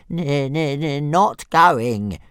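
high, loud stammering refusal; stubborn little dragon. A narrator's reading. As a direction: dig in, stuttering in a creature voice. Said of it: "said Toothless stubbornly".

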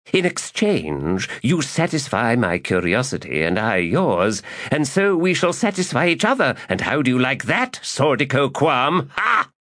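high, triumphant crowing; crowing bargain. Acted. Magus bargaining with the lady, crowing and high. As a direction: gloat and cackle at the priestess.

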